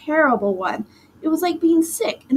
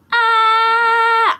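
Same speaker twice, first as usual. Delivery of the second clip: high piercing scream, much louder; 11 semitones higher, 7 dB louder than usual. A narrator's reading. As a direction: let out a long shrill scream of panic. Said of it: "I kept screaming".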